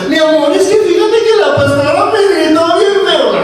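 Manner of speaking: wife whining to husband about the pastor; whiny wife crying to husband. Retold or imitated. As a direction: complain like you were wronged, in a whimpering voice. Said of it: "le lloras al marido".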